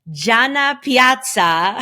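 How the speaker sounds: loud, high, drawn-out Italian flourish; Italian accent. Acted. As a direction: announce the name like a native Italian, with relish. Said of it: "straight off of the plane from Italy".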